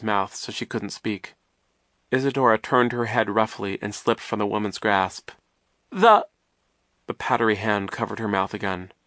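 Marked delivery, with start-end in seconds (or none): 5.9-6.3 high, loud, interrupted shout; urgent broken-off yell; cut-off cry. A narrator's reading. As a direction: begin a desperate cry, then stop dead. Isadora tries shouting again.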